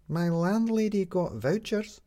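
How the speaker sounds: put-on high, slow child voice; a small girl telling the plain truth; earnest little girl; young girl Midge. A narrator's reading.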